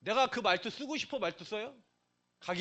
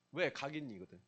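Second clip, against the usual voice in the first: usual speaking voice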